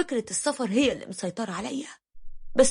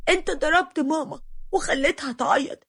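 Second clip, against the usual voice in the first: lightly acted tearful little girl, higher pitch; understated child's wail, pitched up; crying child; 6 semitones higher than usual. A narrator's reading. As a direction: protest like a hurt daughter, mildly weepy. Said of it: "ودنيا بنتي فضلت تعيط وتقولي".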